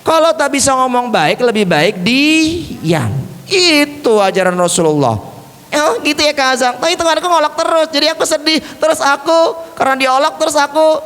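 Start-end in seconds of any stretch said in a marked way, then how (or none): 5.8-11.0 high, quick puppet voice; high-pitched plaintive character voice; sad puppet Ali. Pretend. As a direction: pour out the complaint as a little puppet. Puppet explains why it is sad.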